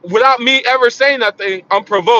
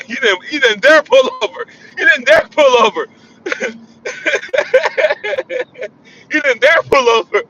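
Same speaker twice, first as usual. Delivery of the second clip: high laughing jeer, still chuckling; laughing tease; 6 semitones higher than usual. Real, not acted. Laughing at the friend who wouldn't pull over. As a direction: tease him through laughter.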